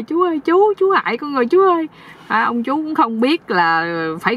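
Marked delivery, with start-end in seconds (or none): 0.0-1.9 high-pitched pleading nephew; aggrieved wail. Acted. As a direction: lament to the uncle in a high voice. Nephew wails at the uncle. (giọng cao, than vãn)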